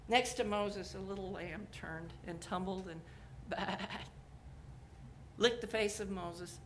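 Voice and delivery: normal talking voice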